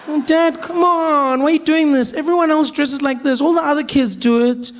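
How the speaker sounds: high whining protest; whiny teen daughter. Retold or imitated. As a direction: plead like a sulky teen.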